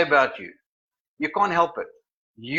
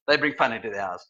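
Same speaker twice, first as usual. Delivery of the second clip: ordinary talk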